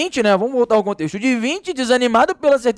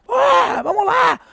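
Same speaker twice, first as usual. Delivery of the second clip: high excited cheer; cheering crowd; slower than usual. Retold or imitated. Teacher imitating frogs cheering the racers, a high excited cheer. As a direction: cheer them on from the edge.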